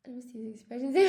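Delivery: barely voiced aside to herself; whisper. Real, not acted. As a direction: breathe it out under your breath. Reading comments under her breath.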